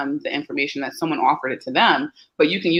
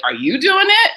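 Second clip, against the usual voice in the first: much higher, louder accusation; accusing parent; 7 dB louder than usual. Retold or imitated. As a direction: blurt it out like a suspicious parent. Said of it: "the last thing you wanna do is be like".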